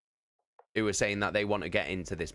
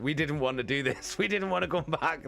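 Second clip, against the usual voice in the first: higher, pleading voice that cracks into a laugh; mock begging; 7 semitones higher than usual. Party begging the dragon to spare them, mock pleading. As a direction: beg for your life, hammed-up and giggling. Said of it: "begging for our lives".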